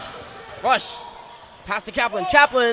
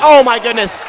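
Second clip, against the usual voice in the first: louder, amazed courtside reaction; real astonishment from the announcer; amazed exclamation; 7 dB louder than usual. Real, not acted. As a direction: exclaim it loudly, caught up in the play. Reacting to big play.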